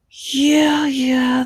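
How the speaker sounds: high, loud emphatic yeah. Real, not acted.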